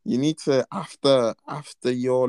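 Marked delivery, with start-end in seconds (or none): none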